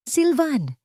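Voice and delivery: sharp high cry of the name; startled father's shout. A narrator's reading. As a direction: call out to stop the boy. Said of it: "sigaw ni Alessandro".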